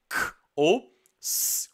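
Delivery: "K ou S" bem devagar, som de letra; os dois sons do C. Shown as a demonstration. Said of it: "O C tem dois sons". (sons do C: "K" e "S" alongados)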